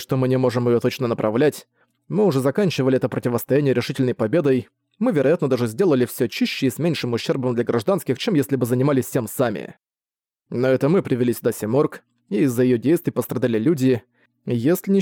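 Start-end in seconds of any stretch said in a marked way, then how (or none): none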